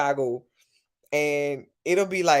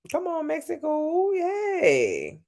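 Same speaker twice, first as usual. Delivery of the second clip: cheerful squeal; 12 semitones higher, slower than usual. Real, not acted. Cheering viewer from Mexico.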